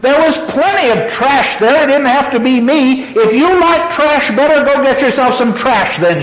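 high, complaining wail, read aloud. A narrator's reading. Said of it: "she wailed".

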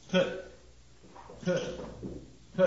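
little bursts of a bare "p", spaced apart; isolated plosives. Shown as a demonstration. Lecturer showing the consonants in petty pace, isolated plosives. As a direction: pop each "p" on its own with pauses. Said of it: "We get the little T sound".

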